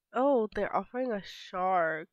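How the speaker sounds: ordinary talk, nothing marked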